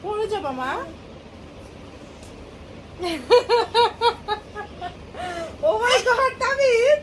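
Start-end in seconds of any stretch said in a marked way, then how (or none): 0.8-5.6 long delighted burst of laughter; long real laugh. Real, not acted. 5.7-7.0 surprised exclamation. Real, not acted. Surprised at baby.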